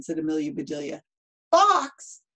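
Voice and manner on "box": high, loud burst of disbelief; shocked exclamation. Acted. Missus Rogers hears the chicken is boxed, shocked exclamation. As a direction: exclaim it loudly, pitched right up. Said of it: "exclaimed Missus Rogers".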